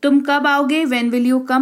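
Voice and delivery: ordinary delivery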